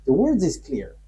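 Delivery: ordinary talk, nothing marked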